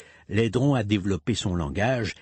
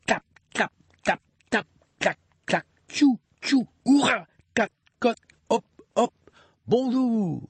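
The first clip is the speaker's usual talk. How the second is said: much higher playful run of noises; all the sound effects; 12 semitones higher than usual. Pretend. Parent finishing the song with all the sounds, gleeful and high. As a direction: rattle off every sound gleefully. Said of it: "fais tout ça".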